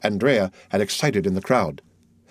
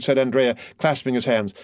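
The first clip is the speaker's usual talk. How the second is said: plain reading voice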